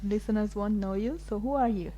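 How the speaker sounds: normal talking voice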